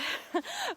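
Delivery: short high giggle; little breathy laugh; light laugh. Real, not acted. Laugh mid-sentence.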